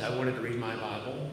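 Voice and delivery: ordinary talk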